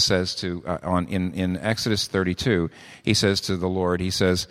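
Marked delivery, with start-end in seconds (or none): none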